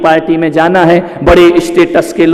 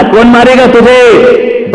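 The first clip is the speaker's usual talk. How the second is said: exasperated father; 8 semitones higher, 5 dB louder, slower than usual. Retold or imitated.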